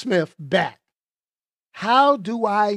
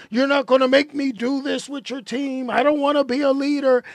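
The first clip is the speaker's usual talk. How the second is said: mock defiant back talk, raised pitch; higher-pitched mock defiance; mouthing off; 5 semitones higher than usual. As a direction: imitate a rebellious kid mouthing off. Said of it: "the mouthing off at Waller".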